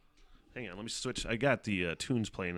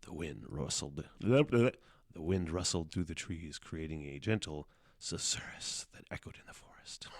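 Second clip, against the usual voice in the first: calm it down and read it gently; subdued storybook read; toned-down reading; 6 semitones lower than usual. Shown as a demonstration. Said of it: "I shouldn't read it like that".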